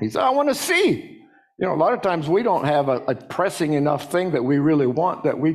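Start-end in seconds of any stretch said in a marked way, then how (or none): 0.2-1.2 high-pitched pleading call, acted; crying out. Blind man answering Jesus, crying out his wish. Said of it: "he yelled out".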